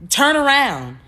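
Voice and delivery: loud, high retold command; urgent order. Big orders his driver mid-drive, urgent order.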